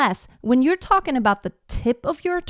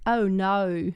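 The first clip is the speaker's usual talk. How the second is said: Australian accent. Shown as a demonstration. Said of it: "I'm trying to say it in an Australian way".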